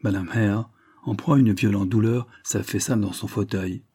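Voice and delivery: ordinary delivery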